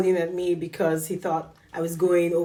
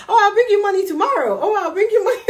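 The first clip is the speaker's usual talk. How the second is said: high, loud, laughing eagerness; hammed-up, giggly keenness; eager promise; 12 semitones higher, 7 dB louder, faster than usual. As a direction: gush it out, pitched way up. Acts out her eager promise.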